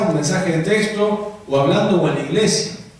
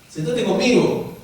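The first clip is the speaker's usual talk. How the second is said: a higher, slow, pleading sit-with-me; mock schoolkid begging a friend to sit close; eager invitation; 6 semitones higher, slower than usual. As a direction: coax a classmate to sit beside you.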